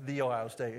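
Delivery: "The" as THEE, mildly mocking; emphatic definite article. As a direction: lean on "The" as THEE. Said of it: "rolls their eyes whenever they hear that".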